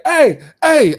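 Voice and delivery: high, loud excited hollering; mimicking an overexcited country dad; excited phone greeting. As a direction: yell hey like a thrilled dad on the phone. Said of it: "get on the phone and get excited".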